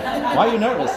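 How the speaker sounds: high, loud laughing disbelief; imitating the bride's astonished question; surprised bride.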